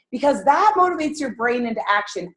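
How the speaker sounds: ordinary talk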